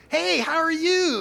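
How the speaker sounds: high, loud, slow sing-song hello; drunk dialing greeting. Retold or imitated. Caller drunk dialing an ex, high slow hello. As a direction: croon it like a tipsy late-night caller.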